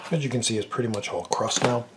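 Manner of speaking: ordinary talk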